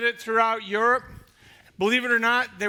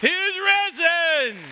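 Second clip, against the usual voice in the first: high, loud, drawn out; slow proclamation; 9 semitones higher, 7 dB louder, slower than usual. Acted. Pastor proclaiming to the church, slow and loud. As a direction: call it across the whole church. Calm down.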